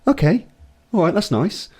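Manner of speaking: high, mock-incredulous echo; sarcastic disbelief. Player mocks the ref's refusal, high sarcastic echo. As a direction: throw his words back sarcastically. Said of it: "I've gone".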